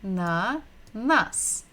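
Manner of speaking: slowly, with the stress on the last syllable of ananas. Shown as a demonstration. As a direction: lean on the final syllable of ananas. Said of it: "we are stressing the final syllable".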